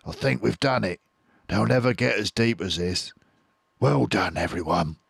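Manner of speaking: a hint of relieved fox; relieved Mister Fox. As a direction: praise them, relieved. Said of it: "said Mister Fox".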